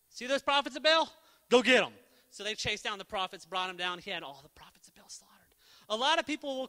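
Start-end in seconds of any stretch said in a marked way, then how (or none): 0.1-1.9 louder, rising battle order; commanding shout. Retold or imitated. Elijah ordering Israel to seize the prophets, a loud command. 4.0-5.5 unvoiced grim whisper. Real, not acted. Preacher whispering the slaughter of the prophets to the congregation. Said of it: "whispered aside".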